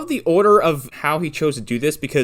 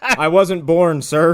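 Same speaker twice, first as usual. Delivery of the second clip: slow, louder, laughing through it; laughing reply; 4 semitones higher, 6 dB louder, much slower than usual. Retold or imitated. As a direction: laugh the words out slowly. Joking Needlemeyer reply, laughing.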